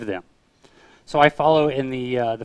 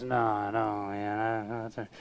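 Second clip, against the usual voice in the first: low, very soft mumble; drugged man imitation; 4 semitones lower, 10 dB quieter, slower than usual. Drugged man answering an offer of prayer, low mumbled imitation. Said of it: "that was just like that".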